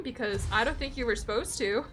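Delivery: usual speaking voice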